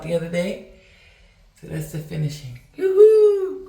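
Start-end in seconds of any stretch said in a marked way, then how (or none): 2.8-3.7 high happy yoo-hoo shout; excited whoop. Real, not acted. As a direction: squeal it out, high and loud. Showing finished grout.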